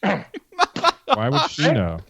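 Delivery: laughing. Real, not acted. Joking about asking his mother.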